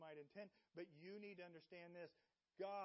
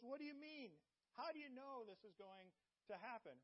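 incredulous; 6 semitones higher than usual. Acted. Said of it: "He says".

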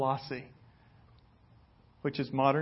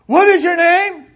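scolding shout; 19 dB louder than usual. Pretend. Paul imagined scolding the congregation.

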